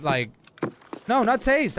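ordinary delivery

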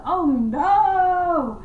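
high, loud frightened yelp; a kid's terrified oh no; panicked cry. Retold or imitated. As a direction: yelp it like the ghost just appeared. Seeing the ghost, panicking.

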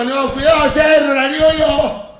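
loud, high urgent call-out; excited shout. Real, not acted. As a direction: yell a warning mid-fight. Tense round, warning teammate. (grito de alerta)

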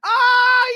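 a loud high burst of laughter; high shrieking laugh. Real, not acted. Reactor cracks up at the fan's fury, loud high shriek of laughter. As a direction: shriek with laughter at the angry fan.

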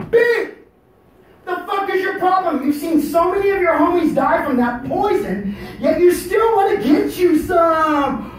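high, full-volume tirade at a bee; shouted scolding. Retold or imitated. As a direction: bellow it like confronting a reckless friend.

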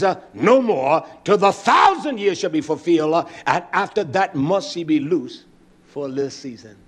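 emphatic, stretched key words in a sermon cadence; forceful scripture preaching. Real, not acted. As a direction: proclaim the verse, punching phrase by phrase.

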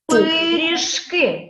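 "Пиріжки" slow, with lengthened syllables; teacher's slow model of "Пиріжки"; drawn-out sounding-out. Shown as a demonstration. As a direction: draw out "Пиріжки" so each sound is heard. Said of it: "це слово промовимо".

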